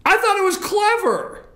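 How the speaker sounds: high-pitched, eager insisting; pushing hard for agreement, voice up high; insistent and excited. Real, not acted. Host pressing cohost for praise.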